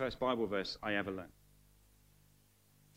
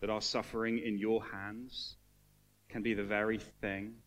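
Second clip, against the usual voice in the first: prayer; 2 semitones lower than usual. Acted. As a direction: pray over the room gently. Leads closing prayer.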